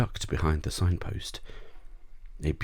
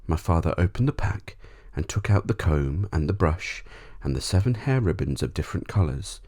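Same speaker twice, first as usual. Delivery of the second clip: plain reading voice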